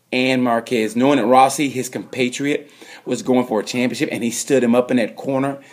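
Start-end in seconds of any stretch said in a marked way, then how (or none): none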